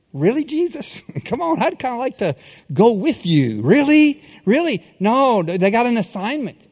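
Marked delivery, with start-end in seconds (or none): 0.1-4.8 pitched-up incredulous "Really?"; mock disbelief. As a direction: play at being shocked, half joking. Mock disbelief at Jesus' request.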